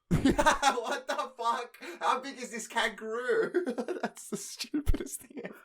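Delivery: cracking up at an absurd story; laughing in disbelief. Real, not acted. Co-host cracking up at the kangaroo tale, laughing disbelief.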